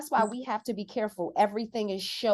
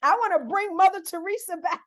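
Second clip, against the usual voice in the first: higher and louder, building to a near shout; fired-up outburst; 8 semitones higher, 4 dB louder than usual. Real, not acted. Fired-up call to protest.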